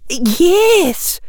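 high-pitched teacher voice; professor's reply. Acted. Professor confirming her offer to a worried pupil, high voice. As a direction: answer firmly, pitched well up. Professor answers tearful student.

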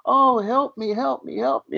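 high, frantic mock plea for help; panicked pleading. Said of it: "she's screaming and yelling".

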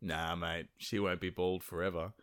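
low, soft and calm with menace under it. Acted. Thor answering his brother Loki, calm soft low voice. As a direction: keep it quiet and level, barely above a murmur. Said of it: "Thor responds calmly".

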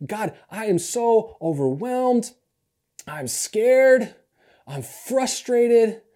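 high, loud, slow cry of being overwhelmed; anguished confession. Acted. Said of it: "In other words, Jesus is saying".

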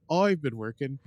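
Irish accent with broad "oi" for I; attempted Irish accent. Shown as a demonstration. Host practising for cohost, amused attempt at Irish accent. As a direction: try Irish, leaning on the vowels. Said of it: "I know the eyes become always".

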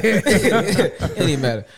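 cracking up while repeating; laughing repeat. Real, not acted. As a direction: lean into the laugh and stretch it out.

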